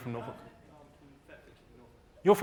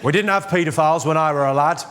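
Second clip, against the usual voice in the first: broad mock Yorkshire accent, a bit louder; 5 dB louder than usual. Comic telling a joke in mock Yorkshire to patronise the crowd. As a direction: put on a hammed-up Yorkshire voice. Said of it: "I'll tell it in a Yorkshire accent to patronise you".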